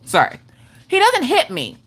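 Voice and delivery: usual speaking voice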